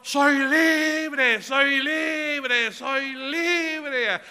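imitating a man crying out, very high and loud; exultant shout. Preacher re-enacting the healed brother's cry, exultant shouting. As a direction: shout it like someone just set free. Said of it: "Así gritaba este hermano".

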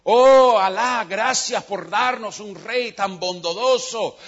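loud, slow, sweeping praise to the king's window. Acted. Beggar Nasradín calls up to the king, loud and slow. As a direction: lay the flattery on thick and loud. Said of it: "en voz alta".

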